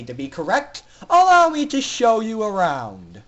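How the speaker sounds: mock the prisoners with cruel pleasure; sneering villain doctor. Doctor Atmosphere sneering at the captive foals, loud and cruel.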